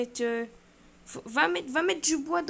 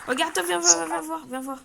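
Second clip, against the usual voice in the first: loud, higher call to come over; yelling across the room; 5 semitones higher, 5 dB louder than usual. Real, not acted. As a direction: call them over, raised. Calling partner over to look.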